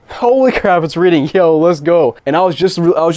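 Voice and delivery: loud, high burst of delighted surprise; sudden high-pitched hype; real excitement. Real, not acted. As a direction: exclaim it loudly, thrilled that it works. Disc unexpectedly starts reading.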